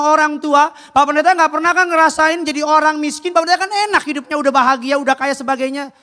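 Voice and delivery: high, loud resentful rant at the pastor; broken-home teen lashing out; angry youth. Retold or imitated. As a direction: throw it back at the preacher with contempt. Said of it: "Dia bilang".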